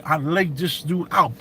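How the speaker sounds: ordinary delivery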